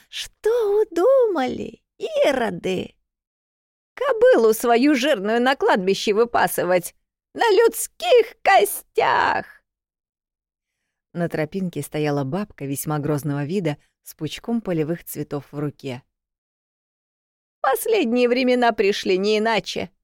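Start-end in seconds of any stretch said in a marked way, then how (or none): 0.1-9.4 высокий дребезжащий старушечий крик; сердитая бабка кричит, растягивая слова; grumpy old woman. A narrator's reading. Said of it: "дребезжащий старческий голос". 17.6-19.9 высокий старушечий голос, вещает; зловещее пророчество старухи; grumpy old woman. A narrator's reading. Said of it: "вещала она".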